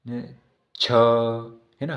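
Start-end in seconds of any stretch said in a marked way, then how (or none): 0.7-0.9 "छ" drawn very long with audible h; aspirated consonant. Shown as a demonstration. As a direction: stretch "छ" on a puff of air. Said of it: "ह की ध्वनि सुनाई दे रही है". ("छ" को महाप्राण, खूब खींचकर)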